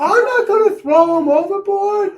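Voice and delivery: high, loud mock shout; hammed-up yelled refusal; arguing crook. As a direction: holler back at your partner in crime. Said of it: "having this conversation like".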